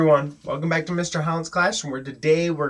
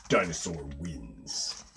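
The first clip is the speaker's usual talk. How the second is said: low, slow, soft declaration; hushed verdict; 11 semitones lower, 8 dB quieter, much slower than usual. A narrator's reading. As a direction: declare the winner low and unhurried. Narrator declares each round's winner.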